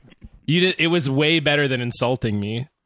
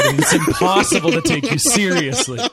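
laughing, higher and louder; 8 semitones higher, 3 dB louder than usual. Real, not acted. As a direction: laugh through the words. Laughing at whipped cream beard.

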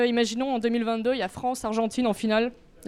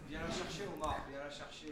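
murmured plea; 7 semitones lower, 17 dB quieter than usual. Real, not acted. Fan pleads jokingly with Omar.

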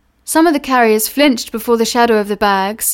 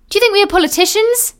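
mocking retort; 10 semitones higher, faster than usual. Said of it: "said the other".

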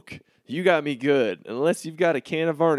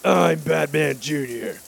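gravelly Batman voice; 2 semitones lower, slower than usual. Shown as a demonstration. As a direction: growl it like a brooding vigilante. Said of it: "that's the way I'll say it from the shadows".

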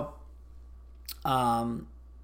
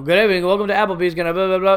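fast, louder canned greeting rattled off; rote greeting like a tape; 3 semitones higher, 6 dB louder, faster than usual. Retold or imitated. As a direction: rattle it off like a recording. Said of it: "it might as well be a tape recording".

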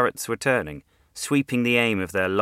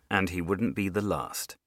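usual speaking voice

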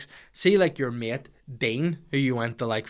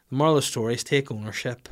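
ordinary talk, nothing marked

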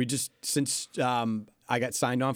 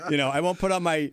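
ordinary talk, nothing marked

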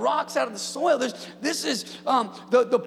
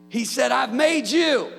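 full-force preaching; 4 semitones higher, 4 dB louder than usual. Acted. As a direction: proclaim it to the congregation with fire.

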